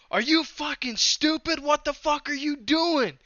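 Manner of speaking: high, angry, slowed shout at the other driver; retold furious yell. Racer yelling over game chat at the car that wrecked him, furious. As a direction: blast it over the mic at whoever hit you. Mic'd up after being wrecked.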